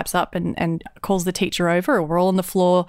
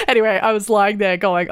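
ordinary delivery

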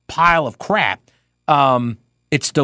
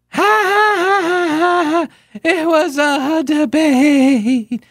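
high, slow cackling laugh as an annoying politician; mocking Kamala Harris laugh; 13 semitones higher, much slower than usual. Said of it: "mimic how she sounded".

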